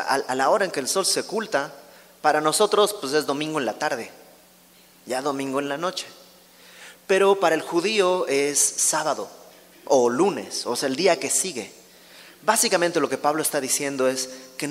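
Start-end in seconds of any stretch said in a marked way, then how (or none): none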